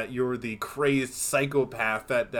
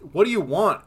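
raised, louder acted confrontation; retold exasperated demand; 7 semitones higher, 9 dB louder than usual. Driver finally confronts the evasive hitchhiker, exasperated. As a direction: imitate a driver losing patience.

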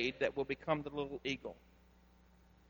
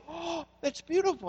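high, breathy-free gushing server voice, pitched way up; impressed server; 11 semitones higher than usual. Retold or imitated. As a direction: coo it like a charmed waitress. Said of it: "And they usually go".

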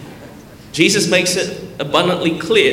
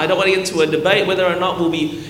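ordinary talk, nothing marked

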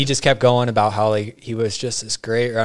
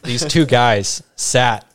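ordinary talk

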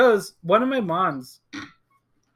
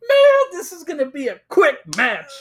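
hammed-up overconfident trash talk; cocky gloating; 8 semitones higher, 5 dB louder than usual. Player sizing up a self-confessed weak opponent, cocky.

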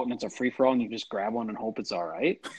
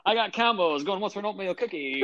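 high, fast auction calling; auctioneer; 9 semitones higher than usual. Retold or imitated. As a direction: hawk snacks like an auctioneer. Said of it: "it's almost like an auction".

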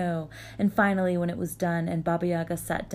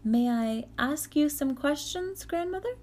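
put-on young girl voice; 9 semitones higher than usual. Vasilisa asks politely.

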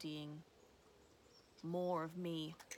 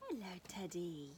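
usual speaking voice

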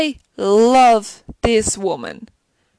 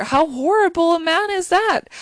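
indignant outburst; 7 semitones higher than usual. Real, not acted. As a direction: exclaim in disbelief at his cruelty. Outraged at the cruel father.

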